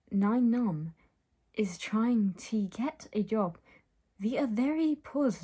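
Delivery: halting, badly faked French accent. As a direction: play a foreign boy with clumsy French accent. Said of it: "how French accents worked".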